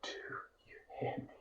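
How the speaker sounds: whispered refrain of a poem. Acted. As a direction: barely breathe the refrain.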